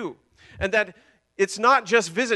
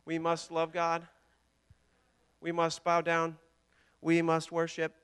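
monotone mock robot, word by word; poor robot imitation; 2 semitones lower, 4 dB quieter than usual. Preacher jokingly playing robots made to worship, poor robot imitation. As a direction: drone the obedient phrases with no inflection. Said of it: "my poor imitation of a robot".

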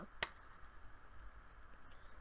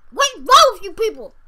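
exasperated shout; 7 semitones higher, 8 dB louder than usual. Real, not acted. Disbelief at poll result.

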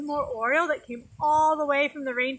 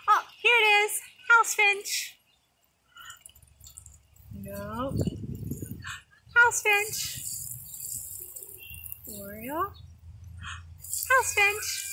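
excited spotting; 7 semitones higher than usual. Shown as a demonstration. Teacher plays the spotting game, excited. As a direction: call it out with delight. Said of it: "I used to say".

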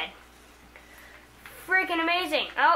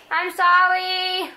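loud, higher, drawn-out apology; stretched, raised-voice sorry; called-out apology; 4 semitones higher, 5 dB louder, much slower than usual. Real, not acted. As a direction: call it slowly across the house to mom. Calls apology to mom.

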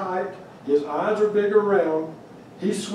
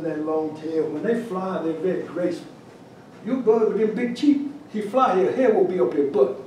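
put-on Cajun accent. Cajun judge critiques the carver's pintail cheeks, Cajun accent. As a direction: do a Cajun judge building to a punchline. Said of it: "try to do my Cajun accent".

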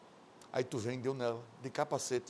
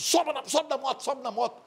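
mock pushy guy; 10 semitones higher than usual. He insists she ride.

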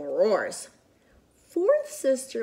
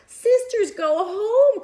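put-on high, loud baby call; baby's cry; 12 semitones higher, 8 dB louder, slower than usual. Baby sister telling her sisters to go home, high loud call. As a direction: call out like a proud little baby. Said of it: "cried baby seventh sister".

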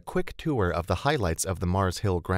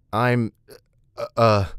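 halting, louder fumbling for words; tongue-tied stammer; 4 semitones higher, 7 dB louder, slower than usual. A narrator's reading. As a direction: stall on filler sounds, flustered. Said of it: "I stammered".

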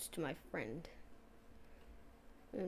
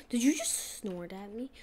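indignant, slower second ask; mock offended; 7 dB louder than usual. Player to the snorting pig, mock indignant. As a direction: play it like the pig insulted you.

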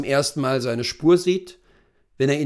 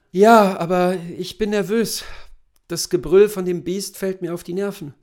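lightly voiced nervous husband, somewhat higher; 5 semitones higher, 4 dB louder than usual. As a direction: confess frayed nerves to your wife, mildly. (leicht nervöser Ehemann)

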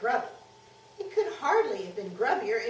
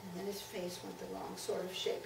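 ordinary delivery, nothing marked

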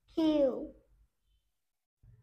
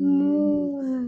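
loud mooing like a cow; 9 dB louder than usual. Pretend. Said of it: "The cow says moo".

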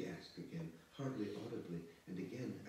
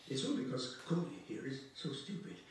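high, loud, agitated woman's voice; flustered scolding woman; 6 semitones higher, 5 dB louder than usual. Acted. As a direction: complain loudly, voice leaping high.

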